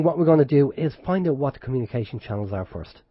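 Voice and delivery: ordinary talk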